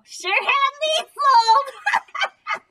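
very high, slow cheeky dummy voice; sassy puppet punchline; squeaky ventriloquist dummy. Acted. Dummy cracks a joke.

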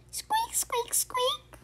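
very high breathy squeak; one sharp little squeak; tiny squeak. Acted. Baby creature answering Fox, squeaking.

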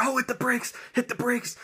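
high, panicky mock cry to hit the brakes; panicked cry. Mom panicking at the wheel, high panicked squeal. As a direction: squeal it like a frightened passenger. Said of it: "she starts panicking".

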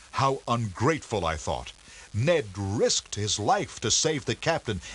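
usual speaking voice

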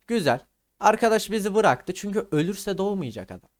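normal talking voice

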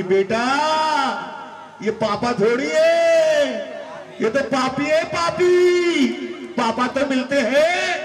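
hammed-up scolding mother; shrill insisting mother.

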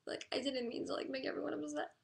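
real tearful, high-pitched voice; upset and on the verge of sobbing; crying. Real, not acted. Streamer feeling everyone is mad at her, crying. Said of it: "I'll stop crying".

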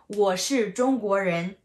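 ordinary talk

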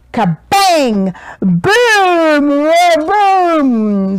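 loud, high booming crash; thunder crash. A narrator's reading. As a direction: explode with the thunder. Thunder sound words.

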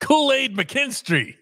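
"Kool-Aid McKinstry" high, slow and drawn out; grand, reverent name. Shown as a demonstration. Showing the co-host how to honor the name, "Kool-Aid McKinstry" high and stretched. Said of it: "pausing to respect the man's name".

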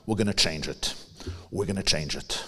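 soft, low, hushed promise; quiet resolve. Retold or imitated. Rabbi quoting his late friend's vow, hushed and soft.

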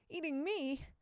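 high-pitched incredulous comeback; indignant echo. A narrator's reading. Boy answering his friend's taunt, indignant.

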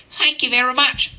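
hammed-up little old lady thanks. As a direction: squeak it out like a sweet old lady. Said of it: "said the old lady".

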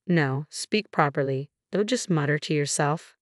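ordinary delivery, nothing marked

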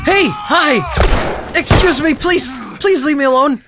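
loud, high begging; flustered yelling with comic courtesy; panicked mock-polite pleading. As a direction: shout for them to back off. Begging attackers to back off.

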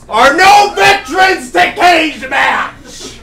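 high, loud, drawn-out announcer shout; hyped announcer shout. Acted. Host builds up the challengers to the crowd, hyped shout. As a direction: shout it slowly to rile the crowd.

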